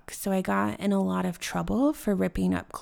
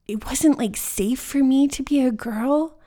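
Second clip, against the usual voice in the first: raised, louder voice of lingering anger; real anger; 4 semitones higher, 7 dB louder than usual. Real, not acted. Explaining it wasn't safe to be a girl, openly angry. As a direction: push it out higher and louder, still upset. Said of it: "I still feel anger in my body".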